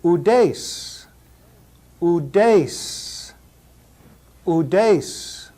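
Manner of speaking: udes as HOO-dace, breathy h and oo; udes, careful Greek demo; Greek diphthong. Shown as a demonstration. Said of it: "Another word built off of that diphthong".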